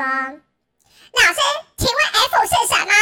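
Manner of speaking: loud shouted question. Retold or imitated. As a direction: yell the question at the teacher. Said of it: "大吼地问了我一次". (学生大吼着问老师)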